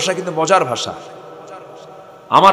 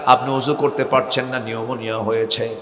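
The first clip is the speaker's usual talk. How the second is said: ordinary talk, nothing marked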